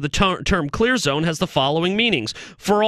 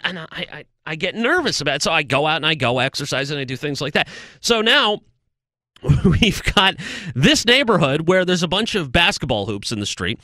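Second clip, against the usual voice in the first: ordinary talk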